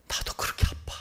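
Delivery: soft whisper of shared pain; whispered confiding. Acted. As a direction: admit it in a whisper. Sufferer confides pain in return.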